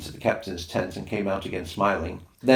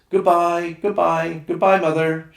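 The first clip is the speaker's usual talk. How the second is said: high, loud farewell calls across the water; shouted goodbyes; 9 semitones higher, 7 dB louder than usual. Acted. Children shouting goodbye to mother in the boat, loud and high. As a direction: call goodbye to the boat rowing away. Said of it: "shouted the Swallow's crew".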